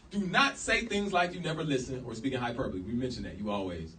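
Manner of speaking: ordinary talk, nothing marked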